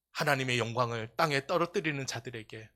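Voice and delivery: ordinary talk, nothing marked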